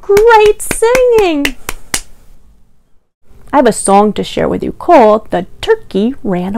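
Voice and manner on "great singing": praise. Acted. Praising children after song.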